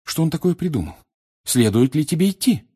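high-pitched anxious query; worried questioning. A narrator's reading. Hermione doubts Harry should go, worried.

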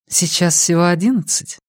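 high feminine character voice; woman protesting mildly. A narrator's reading. Woman replying to Rex about the hour, high character voice.

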